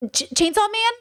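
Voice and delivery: high, incredulous "Chainsaw Man?"; mock disbelief. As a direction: stammer it out in fake shock. Mock disbelief at big three.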